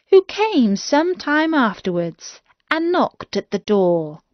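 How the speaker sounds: ordinary delivery, nothing marked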